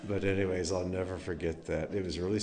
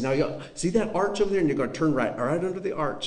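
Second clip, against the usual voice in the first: put-on Aunt Royce Tennessee accent; 8 semitones higher, 4 dB louder, faster than usual. Nephew imitating his aunt inviting them, eager Tennessee accent. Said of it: "the strongest Tennessee accent".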